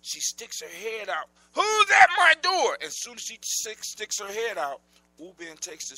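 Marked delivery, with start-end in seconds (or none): 1.5-2.8 high, slow, loud witch demand; angry witch. Acted. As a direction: bark it out as the furious witch. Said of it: "the witch says angrily".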